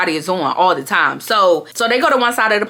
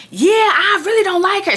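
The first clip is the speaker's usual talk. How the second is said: put-on high-pitched drunk friend's complaint; mock yelling; 11 semitones higher than usual. Drunk friend complaining about her, mock yelling. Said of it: "now she's yelling at Day Day".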